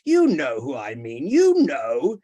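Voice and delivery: loud, rising demand; furious insistence. Acted. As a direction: insist loudly with fury. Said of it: "looked hard and furiously at Guy".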